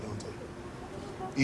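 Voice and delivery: breathy whispered question. Real, not acted. Speaker to the gym of students, a hushed whispered question. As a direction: drop to a bare whisper.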